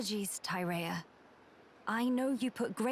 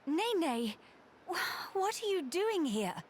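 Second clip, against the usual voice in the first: higher, stammering surprise; startled; 8 semitones higher, slower than usual. Acted. Woman finding an unexpected eavesdropper, startled. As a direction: caught off guard by an arrival.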